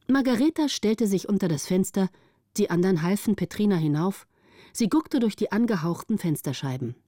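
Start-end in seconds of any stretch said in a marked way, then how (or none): none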